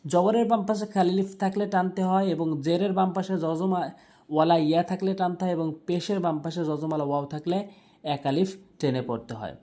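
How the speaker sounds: normal talking voice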